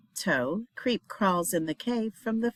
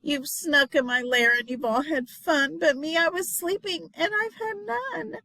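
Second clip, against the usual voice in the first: whiny complaint; 8 semitones higher than usual. Acted. Bear complains.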